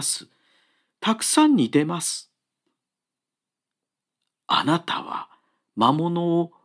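an astonished woodcutter finding a stranger; surprised. Acted. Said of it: "驚きの調子で言った". (驚いた調子で)

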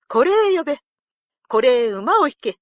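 loud, emphatic lordly order; commanding. A narrator's reading. Lord summoning retainer and horse, commanding loudly. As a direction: call out the order with authority.

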